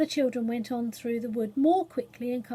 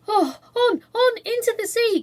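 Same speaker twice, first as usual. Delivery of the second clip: high, loud, breathless gasping cry; panting out the words mid-run; breathless shout while running; 11 semitones higher, 8 dB louder than usual. A narrator's reading. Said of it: "gasped Cyril".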